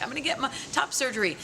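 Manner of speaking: high, quick party squeal; mock excitement. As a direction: imitate someone gleefully announcing big news. Voicing excited party-goers in anecdote.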